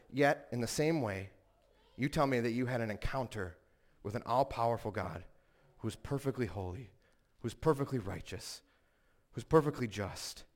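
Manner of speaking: measured preacher, lightly imitated, low and hushed; Paul Washer. Preacher answers doubting young people, low measured imitation. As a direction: lightly voice a grave preacher, pausing between phrases. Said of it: "the way Paul Washer responds".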